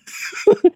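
unvoiced high giggle; airy laugh in disbelief; breathy high laugh. Real, not acted. Laughs after exasperated line.

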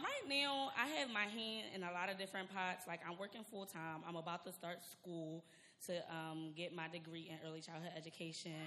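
normal talking voice

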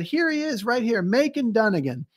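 high, delighted reveal; thrilled to show the post; excited find. Retold or imitated. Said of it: "she got so excited about it".

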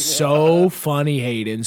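slow, drawn-out mock praise, dropping on the name; sarcastic mom. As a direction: imitate a mean mother's sarcasm. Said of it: "I knew it was coming".

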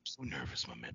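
whispered Jake line. Shown as a demonstration. Teasing the co-host with the Jake line, whispered. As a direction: breathe it like Jake the Snake. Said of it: "repeating the Jake line".

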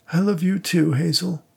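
tender reply. A narrator's reading. Widower answers dead wife.

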